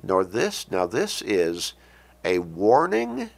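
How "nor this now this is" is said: normal talking voice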